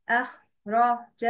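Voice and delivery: "أخرج" slowly, broken into separate parts with pauses; broken-up demo. Shown as a demonstration. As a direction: break "أخرج" into slow, separate pieces. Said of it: "Dividing a word according to its letters". (نطق "أخرج" ببطء مقطّعة)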